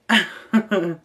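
sudden loud laugh; loud burst of laughter. Real, not acted. Reading an explicit message to viewers, loud laugh. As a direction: crack up out loud.

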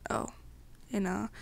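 usual speaking voice

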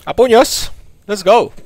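loud, high hype yell; excited shout. Real, not acted. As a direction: shout it out, pumped up. Excited shout starting fistfight.

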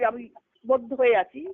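ordinary talk, nothing marked

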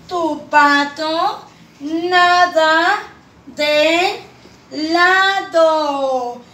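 "Tu pato nada de lado" drawn out as one phrase; whole sentence sung out slowly; slow smooth reading. Shown as a demonstration. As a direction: read it slowly and joined up. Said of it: "Dice la tercera oración".

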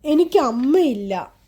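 ordinary delivery, nothing marked

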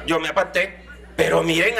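normal talking voice

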